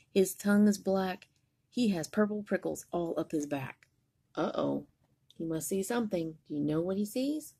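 usual speaking voice

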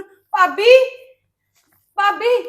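loud rising call across the house. Acted. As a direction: call her name so she hears you from afar. Calling sister-in-law from another room.

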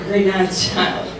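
usual speaking voice

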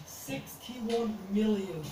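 very slow, awed; slow stunned repeat. Real, not acted. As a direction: savour each word in disbelief. Stunned at view count.

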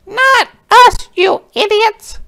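very high, indignant squeal; Missus Pinch. Acted. Woman protesting to the soldiers, squealing. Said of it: "squealed Missus Pinch".

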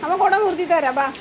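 high sing-song pet talk. Real, not acted. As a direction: coax the dog gently in a high voice. Comforting sad dog in rain.

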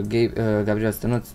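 normal talking voice

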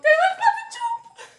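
high, loud terrified shriek; panicked scream. Real, not acted. Grasshopper about to jump.

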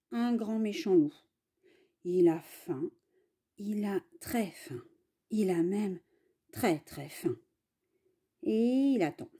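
ordinary delivery

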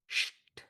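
শিট as bare "sht"; long hush cut off by "t" for শিট; sound demo. Shown as a demonstration. Teacher asks how it sounds, শিট as isolated "sht". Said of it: "এটার উচ্চারণ কিভাবে হবে".

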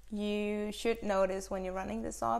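ordinary delivery

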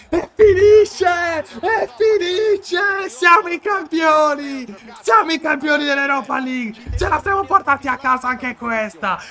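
high, loud stretched victory cry; real championship scream; triumphant shouting. Real, not acted. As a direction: bellow the final whistle. Final whistle, trophy won.

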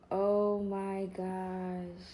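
very slow, drawn-out wonder; awestruck. Real, not acted. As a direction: stretch it out in amazement. Awed at the belly plate.

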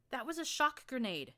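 high, loud exasperated yell; Glory yelling. Acted. Glory yells at the narrator about the grenade, high and loud. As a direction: yell the bad news at her. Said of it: "she yelled".

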